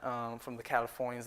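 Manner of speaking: ordinary talk, nothing marked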